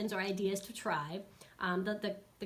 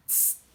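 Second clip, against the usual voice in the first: short sharp "tss" like compressed air; brief hissed correction sound; spray-can hiss. Shown as a demonstration. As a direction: imitate a quick aerosol spritz. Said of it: "What's the sound?".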